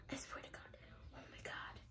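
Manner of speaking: breathy aside, barely audible; whisper. Real, not acted. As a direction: whisper it away from the mic.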